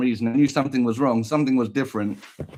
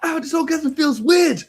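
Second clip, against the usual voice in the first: high, loud strained cry; father in pain at conception; 13 semitones higher, 4 dB louder than usual. Pretend. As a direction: whimper through it. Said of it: "He's like".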